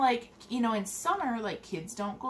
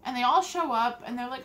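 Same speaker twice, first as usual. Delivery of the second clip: ordinary talk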